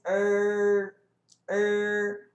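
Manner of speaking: er held with the tongue curled back; English R. Shown as a demonstration. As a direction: sustain er as a retroflex R. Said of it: "Quando você faz o som do R no inglês". (er com R inglês, língua dobrada)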